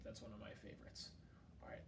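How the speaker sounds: ordinary delivery, nothing marked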